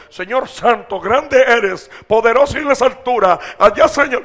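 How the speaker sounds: loud, high, overblown church prayer voice; pompous showy prayer. Retold or imitated. Preacher mocking churchgoers' showy prayers, loud and pompous. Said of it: "Son más bulteros hablando".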